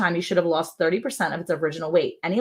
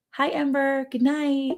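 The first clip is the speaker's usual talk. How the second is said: high, sweet sing-song hello; lilting, raised-pitch greeting; 8 semitones higher, slower than usual. Real, not acted. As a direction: greet a little one in a high voice. Calling a greeting to viewer's child.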